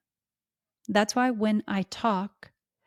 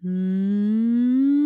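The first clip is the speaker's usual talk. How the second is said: gentle hum; 6 dB louder than usual. Shown as a demonstration. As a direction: hum lightly, just to feel it. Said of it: "start doing something very simple like".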